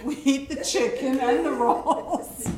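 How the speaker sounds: loud, high and slow through laughter; laughing punchline. Real, not acted. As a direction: crack up delivering the punchline.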